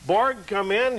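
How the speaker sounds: normal talking voice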